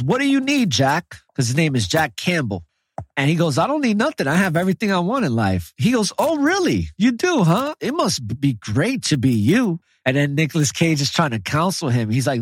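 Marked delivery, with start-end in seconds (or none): 0.0-1.2 higher, teasing put-on question; imitating the angel. Retelling the movie angel to a friend, high teasing voice. 3.6-9.8 much higher, sarcastic put-on voice; mock sarcastic angel. Angel teasing the rich man, high sarcastic voice. Angel mocking in retold movie.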